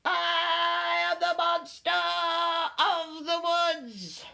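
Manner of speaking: high, drawn-out creature voice; little monster. A narrator's reading. Small monster introducing itself to friends, high proclamation.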